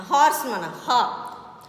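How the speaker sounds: HORSE with the H sounded, then "ha" drawn out; "horse", then its first sound alone, "ha". Shown as a demonstration. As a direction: stress the breathy H that opens "horse". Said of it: "Even though it is consonant".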